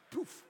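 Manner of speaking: high, airy poof; quick high sound effect of flying out; imitated poof. Imitates shooting out of the car.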